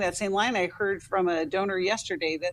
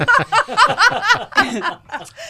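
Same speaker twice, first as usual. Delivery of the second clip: laughing; 12 semitones higher, 8 dB louder than usual. Real, not acted. Host laughs at own joke.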